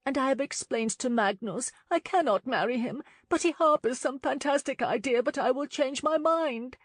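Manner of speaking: lightly voiced lady, mild frustration; young woman voice. A narrator's reading.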